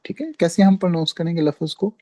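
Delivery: usual speaking voice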